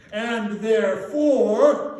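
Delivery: loud, slow, stretched out; drawn-out buildup. Acted. Scrooge teasing Bob before the surprise, loud and slow. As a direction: stretch it out for suspense.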